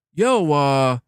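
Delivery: a hint of a lazy gamer dude; hammed-up bro greeting, not how he really spoke; mock bro voice. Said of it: "he wasn't talking like that".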